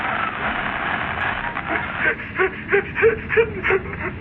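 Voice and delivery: high breathy sneering chuckle; smug little villain laugh; mocking laugh. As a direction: laugh at the joke he just played. Villain laughs at his cruel joke.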